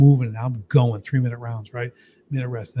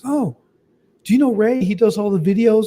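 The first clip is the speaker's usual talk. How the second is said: mimicking a chatty nurse, pitched higher; a light put-on higher nurse voice; imitating the nurse; 8 semitones higher, 3 dB louder than usual. Retelling the nurse's eager question, higher mock voice.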